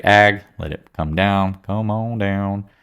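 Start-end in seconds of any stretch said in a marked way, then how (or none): 1.6-2.7 drawn-out slow call; slow coaxing. Pretend. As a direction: coax the price down slowly. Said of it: "coaxing price down".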